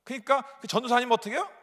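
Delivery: ordinary talk, nothing marked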